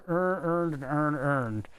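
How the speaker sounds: imitated Baltimore accent, all words run into "earn". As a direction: mimic Baltimore kids merging every vowel. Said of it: "that Baltimore, like, whatever it is, accent".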